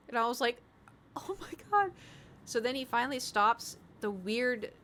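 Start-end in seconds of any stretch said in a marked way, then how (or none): 1.2-1.9 shocked, much higher; surprised. Retold or imitated. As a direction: gasp it out in surprise. Shocked by cat's noise.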